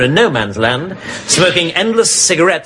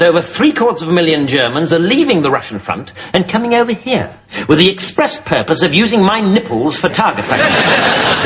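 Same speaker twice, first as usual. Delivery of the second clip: ordinary talk, nothing marked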